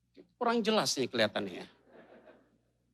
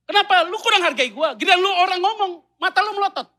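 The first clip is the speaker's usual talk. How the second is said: much higher, loud scolding; mock furious wife berating her husband; angry wife; 14 semitones higher, 9 dB louder than usual. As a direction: snap at him for glaring.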